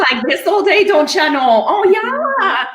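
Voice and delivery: put-on high-pitched singsong Minnesota accent. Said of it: "with a Minnesota accent".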